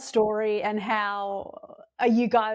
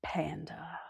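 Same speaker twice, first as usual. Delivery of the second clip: whisper; 7 semitones lower, 12 dB quieter than usual. Real, not acted. Guessing the secret child name.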